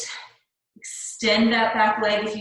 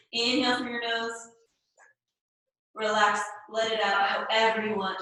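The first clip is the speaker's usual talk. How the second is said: ordinary talk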